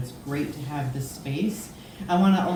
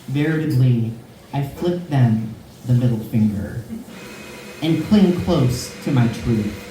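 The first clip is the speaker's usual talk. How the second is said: slow, measured, projected poem reading; deliberate verse delivery with long pauses; poetry recitation; 2 semitones lower, 4 dB louder, slower than usual. Acted. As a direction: declaim it slowly, weighting each phrase. Reads a poem aloud.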